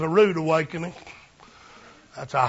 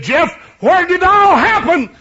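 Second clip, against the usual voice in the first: eager question; 11 semitones higher, 8 dB louder than usual. Retold or imitated. Preacher quoting his question to brother.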